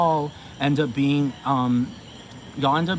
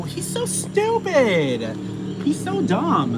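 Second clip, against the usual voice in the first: drawn out, high-pitched, falling at the end; exasperated insult; 11 semitones higher, much slower than usual. Real, not acted. Ranting about Jimmy Fallon's stupidity, exasperated. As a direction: stretch it out high in real exasperation.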